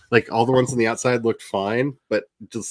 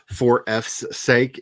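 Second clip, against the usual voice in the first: slow spelled-out phrase; much slower than usual. Shown as a demonstration. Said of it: "discussing grammar".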